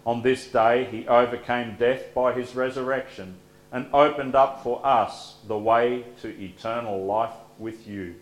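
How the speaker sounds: usual speaking voice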